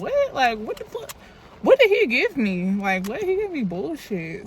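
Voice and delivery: mock outraged outburst, high-pitched "What?"; retold outrage. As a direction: replay the angry disbelief, half-hammed. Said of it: "I was mad as fuck".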